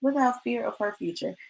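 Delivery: hushed and wondering, turning the words over; musing softly. Retold or imitated. Quoting the verse she meditated on, soft and musing. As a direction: repeat the phrase softly to yourself, like meditating. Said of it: "I was just like".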